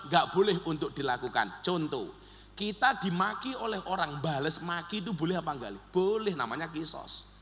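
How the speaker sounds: ordinary delivery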